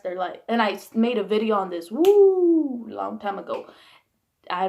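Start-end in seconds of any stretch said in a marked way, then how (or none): 1.9-2.9 loud "woo" swooping high then falling; high drawn-out woo. Real, not acted. Speaker recalling a video made long ago, whooping. Exclaims mid-sentence about old video.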